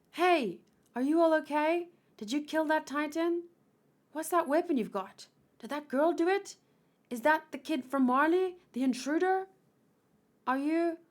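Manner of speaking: high-pitched, pressing interrogation; urgent questioning soldier. Acted. Soldier questioning survivors about the intruder, urgent and demanding. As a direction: press question after question, building to a loud demand.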